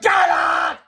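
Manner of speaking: high, loud drawn-out scream; furious principal yell. A narrator's reading. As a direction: scream them out of the building. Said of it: "Mister Krupp screamed".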